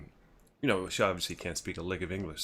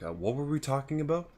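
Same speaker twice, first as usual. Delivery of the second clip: higher, falsely cheery question; imitating fake smile; 8 semitones higher than usual. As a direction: ask it through a stiff fake smile. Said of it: "robotic fake smile".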